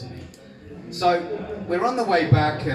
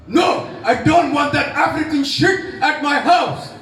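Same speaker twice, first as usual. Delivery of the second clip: furious yell, higher and louder; angry shout; 12 semitones higher, 7 dB louder than usual. Retold or imitated. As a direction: explode at your son like an enraged old dad. Angry father refusing request.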